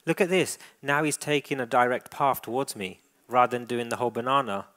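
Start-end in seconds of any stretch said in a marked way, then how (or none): none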